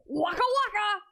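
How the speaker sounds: high, loud comic shout; big high-pitched battle cry of a joke; shouted catchphrase. Acted. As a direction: belt it out like a catchphrase. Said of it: "and shouts".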